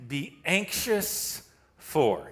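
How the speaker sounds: drawn out very slowly; slow reading. Shown as a demonstration. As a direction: let each word hang. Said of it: "I wanna pause there".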